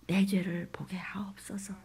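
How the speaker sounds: slow, hushed whispered plea; whispered prayer. Real, not acted. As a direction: pray it under your breath.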